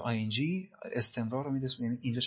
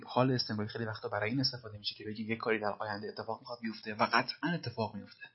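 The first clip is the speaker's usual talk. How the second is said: normal talking voice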